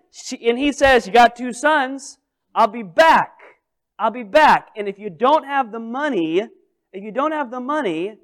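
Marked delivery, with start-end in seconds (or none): 1.1-8.2 lightly acted collector's threat, pitched up; playfully menacing debt collector; mock threatening creditor. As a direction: lay down a mock ultimatum, raised voice.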